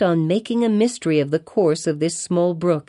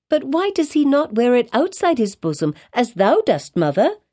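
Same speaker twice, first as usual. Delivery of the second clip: put-on high, questioning little girl's voice; inquisitive child; 5 semitones higher than usual. A narrator's reading. Child questioning her mother about the minister, inquisitive. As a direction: press the question as a curious child.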